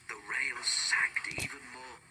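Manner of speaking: ordinary delivery, nothing marked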